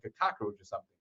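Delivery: usual speaking voice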